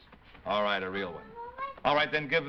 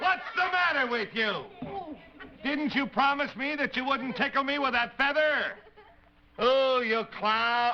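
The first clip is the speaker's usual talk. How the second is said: exasperated; 8 semitones higher than usual. Acted. Tickled, scolding the clown.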